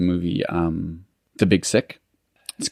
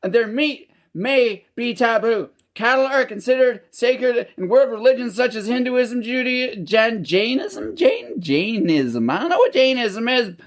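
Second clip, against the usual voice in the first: very high-pitched Canadian trailer-park voice; Canadian pikey accent; 14 semitones higher than usual. Acted. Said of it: "article in that accent".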